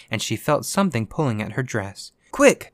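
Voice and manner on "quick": very high, loud exclamation; urgent cry. A narrator's reading. Ghost hurrying Virginia through the wall, urgent cry. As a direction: burst out with sudden urgency. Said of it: "cried the ghost".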